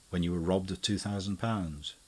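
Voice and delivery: ordinary delivery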